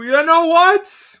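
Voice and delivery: loud, high drawn-out exclamation; big showy outburst; dramatic wind-up. Real, not acted. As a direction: yell it slowly, building suspense. Dramatic wind-up before decision.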